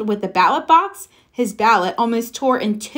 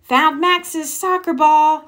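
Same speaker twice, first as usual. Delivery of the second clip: mild yell; 6 semitones higher than usual. Acted. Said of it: "yelled Froggy".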